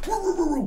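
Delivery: high "woo woo woo" babble; mock arguing noise. Instructor mimics himself arguing back, mock bickering noise. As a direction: imitate a heated back-and-forth with nonsense syllables. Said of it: "going back and forth with him".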